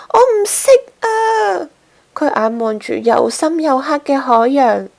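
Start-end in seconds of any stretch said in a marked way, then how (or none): 0.1-1.7 high, slow frightened voice. A narrator's reading. Gasper too scared to swim, crying out. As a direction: plead that you can't swim. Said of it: "但係嘉嘉好驚".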